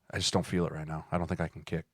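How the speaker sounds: soft, low mumbled excuse; mock timid kicker. Kicker telling the coach he can't kick, soft mumble.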